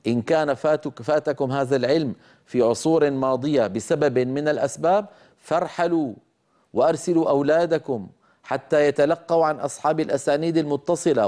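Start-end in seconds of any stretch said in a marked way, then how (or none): none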